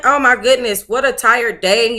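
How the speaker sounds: normal talking voice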